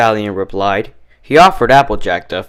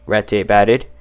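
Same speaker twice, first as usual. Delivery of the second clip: ordinary delivery, nothing marked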